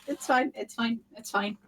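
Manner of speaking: soft, resigned it's fine; nervous quiet reassurance. Retold or imitated. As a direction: mumble it's fine to yourself. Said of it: "Just kind of there, like".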